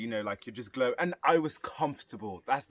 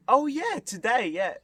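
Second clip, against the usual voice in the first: sudden realisation; 10 semitones higher, 5 dB louder than usual. Retold or imitated. Suddenly remembers it is birthday.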